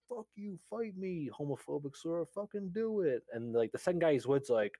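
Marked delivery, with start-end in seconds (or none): 0.1-3.2 mock angry scream. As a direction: imitate an angry guy picking a fight. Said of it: "screams, like".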